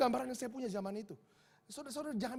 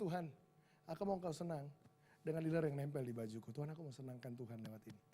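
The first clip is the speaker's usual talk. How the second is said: low, softening heartfelt prayer to God; inner prayer; 6 semitones lower, 6 dB quieter than usual. Retold or imitated. Preacher recalls his heart's prayer to God, low and tender. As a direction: pray it quietly from the heart, sinking lower. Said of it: "apa yang ada dalam hati saya".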